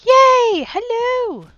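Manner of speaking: high, loud, joyful yay; loud excited cheer. Real, not acted. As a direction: squeal it out with delight. Welcomes herself back loudly.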